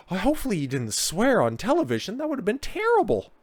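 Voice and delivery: very high, scandalised falsetto; mock horror. Host pretending shock at swearing on TV, mock horror. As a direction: gasp and fret in a squeaky pretend voice.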